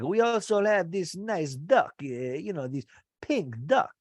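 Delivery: hint of a French chef offering duck; mock French chef. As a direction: chef pitching the duck, light French accent. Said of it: "he's talking French".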